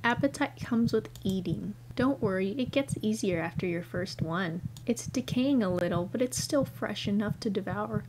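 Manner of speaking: ordinary talk, nothing marked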